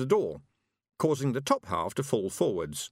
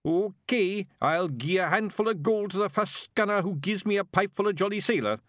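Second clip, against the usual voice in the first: higher Scots yell to the room; shouting a reward to the pub; booming Scots drunk; drunk yelling offer; 9 semitones higher than usual. Acted. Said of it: "it yelled".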